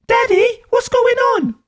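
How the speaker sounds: put-on very high little-kid voice; high-pitched, questioning child; small child. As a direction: pipe up like a child woken by arguing. Woken child calls to parent.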